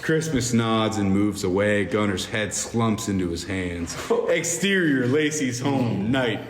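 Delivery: normal talking voice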